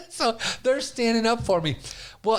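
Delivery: ordinary delivery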